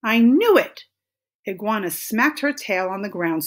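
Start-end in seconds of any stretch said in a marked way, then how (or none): 0.0-0.9 loud, raised-pitch indignation; storybook character's loud, cross exclamation; exasperated outburst. A narrator's reading. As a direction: burst out, fed up with the excuses. Iguana finds she was tricked.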